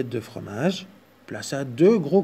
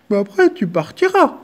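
whining bear; 7 semitones higher, 7 dB louder than usual. Acted. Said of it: "gémit-il".

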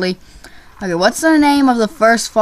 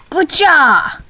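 high, sharp battle yell; playful martial-arts attack shout; mock ninja cry; 9 semitones higher, slower than usual. Gamer swinging a sword as Segway Ninja, mock battle cry.

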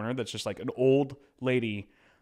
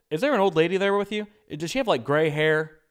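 host on the phone; 7 semitones higher, 6 dB louder than usual. Retold or imitated. Host on speakerphone voiced.

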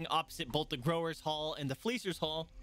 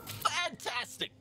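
much higher, slow, delighted cry; delighted innkeeper; 12 semitones higher, much slower than usual. Acted. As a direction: draw out the joy like a thrilled barkeep. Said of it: "I keep changing her accent and her voice".

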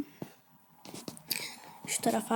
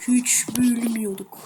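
slow secretive whisper; slower than usual. Shown as a demonstration. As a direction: hush it slowly like a secret. Repeats line, second whispered.

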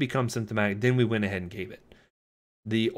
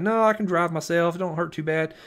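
higher, louder country Florida twang; rural Florida accent; 8 semitones higher, 3 dB louder than usual. Retold or imitated. Caller turning down an ambulance, rural Florida accent. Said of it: "talk like that all the time in my natural accent".

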